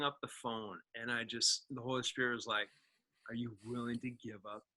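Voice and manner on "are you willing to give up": 1 hushed divine voice. Retold or imitated. Voice of Holy Spirit, hushed.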